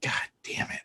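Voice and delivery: whispered, retold disbelief; dismayed undertone. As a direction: curse softly under your breath, as if recounting it. Retold dismayed reaction to discovery.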